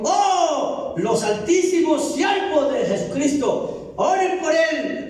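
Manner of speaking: father begging disciples, mock reverent; pleading father. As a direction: beg the great servants to pray. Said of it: "y dijo".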